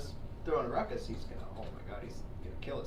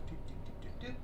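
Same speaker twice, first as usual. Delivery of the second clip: soft mimicked beeps; typing noises; 11 semitones higher, 5 dB quieter than usual. Desk lady entering the prescription, soft mimicked typing beeps. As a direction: tap out the computer sounds.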